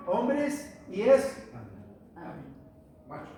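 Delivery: "hombres y es" stretched slowly, then a pause before "macho"; slow emphasis. Real, not acted. To the Bible class, revealing the word change, slow stress. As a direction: let the point land slowly.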